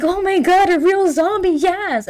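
high-pitched, gushing mock thrill; thrilled Daphne. Daphne meeting a real zombie, hammed-up thrill. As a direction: squeal it in hammed-up delight. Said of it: "And Daphne just like".

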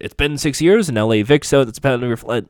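mock the actor dropping into his American accent; imitating American accent slip. Recapper mocking Jason's American accent slipping through. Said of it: "back in his normal accent".

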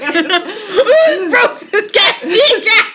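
high, drawn-out comic cry; hammed-up accusation to a crowd; mock outraged shout. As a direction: shout it slowly in pretend horror, half laughing. Mock outcry about sabotaged cat.

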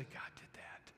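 whispered in wonder; awed whisper. Acted. Preacher whispering what people say about a miracle. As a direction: breathe it out in awe.

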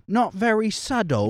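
put-on playful British accent. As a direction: read it in a mock British accent. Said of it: "I forgot the accent".

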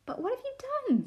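very high-pitched story character voice; high, pleading Lucy voice; little Lucy's question. A narrator's reading. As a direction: pitch it way up, asking in dismay. Lucy questions the sobbing faun.

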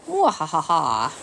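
slow, loud evil cackle; sneering panto villain. Acted. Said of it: "Grandpa Gory laughed".

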